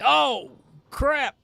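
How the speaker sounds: high, soft startled yelp; a sudden high yelp as he falls; oh crap. Retold or imitated. Startled yelp while falling backwards at the table.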